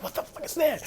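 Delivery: high alarmed cry; shocked at the shape in the dark; startled. Retold or imitated. Spotting something circling him in the graveyard, high alarm.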